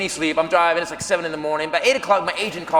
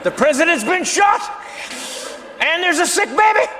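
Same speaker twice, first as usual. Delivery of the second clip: panicked locals; 8 semitones higher than usual. Retold or imitated. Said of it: "These people are like".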